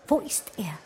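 urgent breathy whisper; barely voiced, pleading; hushed worried question. Anxious woman asks about Sascha.

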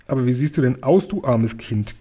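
plain reading voice

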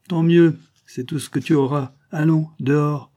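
high, loud and impatient; angry dismissal. Acted. Old man throwing the intruder out.

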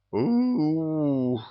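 high wordless howling moan; werewolf howl. Acted. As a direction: howl like a beast. Werewolf howls in reply.

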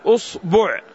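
أصبع said "us-bu'", u in both syllables; short u after hamza and ba in أصبع; damma on both. Shown as a demonstration. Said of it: "فنضم معها الباء نقول".

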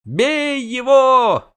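high, slow, stretched war cry; bellow. Acted. Goat-eater urges the mob on, slow loud bellow. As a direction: roar it long and loud. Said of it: "орал козаед".

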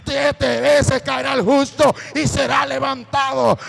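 preaching at full force. Acted. Preacher proclaims Bible verses to the congregation, shouting.

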